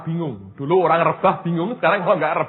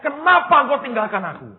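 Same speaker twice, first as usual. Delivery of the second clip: high, pleading imitated cry; imitated shout; 10 semitones higher, 4 dB louder than usual. Preacher asks congregation to imagine Jesus shouting, high cry. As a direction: call it out high, as if crying to God. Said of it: "Bayangin sampai dia teriak".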